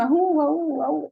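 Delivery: short vowel sounds mimicking a baby; baby babble demo. Shown as a demonstration. Doctor showing students what the baby really says, imitating babble. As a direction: play the infant's wordless babble. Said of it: "está diciendo".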